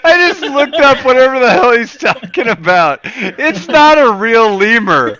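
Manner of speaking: loud, high-pitched protest; incredulous arguing. Real, not acted. Co-host disputes the Zaboomafoo lemur, loud incredulous arguing. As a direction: dispute it with rising exasperation. Heated mock argument about lemur.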